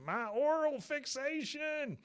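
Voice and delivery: very high, slow anguished cry; mock despair over a lost cigarette; Dale wail. Dale wailing as Bobby runs off with his cigarette. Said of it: "Dale goes".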